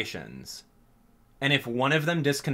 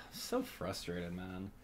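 much softer, slow sigh of defeat; sighing, deflated; 7 semitones lower, 17 dB quieter, slower than usual. Real, not acted. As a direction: let the air out as you say it. Sighing at lost progress.

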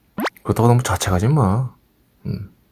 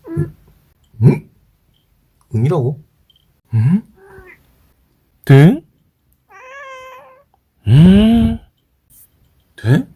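louder, squeaky demanding question; loud high nag; 12 semitones higher, 6 dB louder, slower than usual. Real, not acted. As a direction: push the dog for an answer up high. Loud high nagging the dog.

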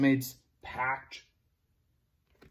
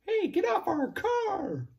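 high indignant shout. Acted. Story character shouts.

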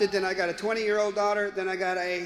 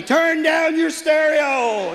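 put-on slow, loud, higher teenage yell; 6 semitones higher, 7 dB louder, much slower than usual. Teen kids yelling at their dad, slow loud yell. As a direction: bellow it at your dad. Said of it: "they yell at me".

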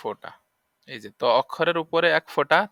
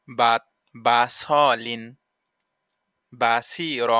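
slow drill; 2 semitones lower, much slower than usual. Shown as a demonstration. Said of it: "slow spelled drill".

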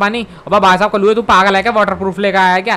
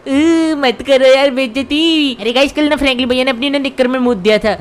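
Shinchan voice; 3 semitones higher than usual. Acted. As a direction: whine like a naughty little cartoon kid. Plays Shinchan voice.

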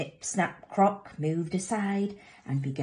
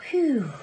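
higher, relieved whew; happy sigh; 7 semitones higher, 4 dB louder than usual. Acted. Mothers sigh happily, audible relief. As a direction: let out a contented sigh. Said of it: "all sighed happily".

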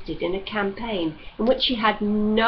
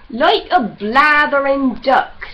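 mocking growl; 5 semitones higher, 10 dB louder, slower than usual. Judd mocks loudly.

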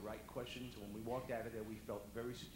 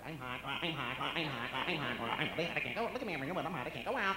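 loud, frantic, repetitive squawk; hot flash complaint, squawked loudly; mom squawking like a parrot; 3 semitones higher, 8 dB louder than usual. Retold or imitated. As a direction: rattle it off like a parrot, louder and louder. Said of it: "talk like a parrot".

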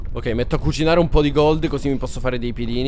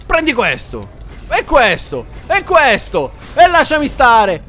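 fighting shout; 10 semitones higher, 5 dB louder, slower than usual. Real, not acted. Combatte il mostro.

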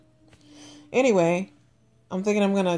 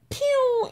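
high quick "pew" like a cartoon exit; zip-away sound. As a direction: zip off with a mock sound effect. Imitating a dash out the door.

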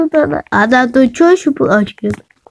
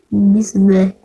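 whisper; 5 semitones lower than usual. Acted. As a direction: whisper the call. Whispered repeat of call.

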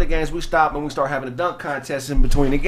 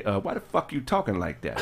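gruff father; 9 semitones lower, 10 dB quieter than usual. Retold or imitated. Father on the phone.

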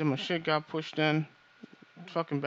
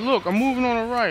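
radio callout; 6 semitones higher, 6 dB louder than usual. Retold or imitated. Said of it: "They fucking radioed each other. Like".

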